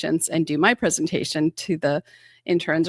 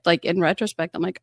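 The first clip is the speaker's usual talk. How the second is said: usual speaking voice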